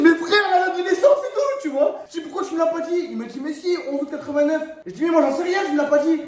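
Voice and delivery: mock-furious retold yelling at friend; exasperated shout. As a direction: yell at your friend in disbelief.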